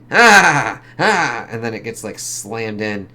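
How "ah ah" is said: loud high yelps of pain; mock screams. Phil screaming as he's slammed, loud high yelps. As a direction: yell out as you get hit. Character attacked in panel.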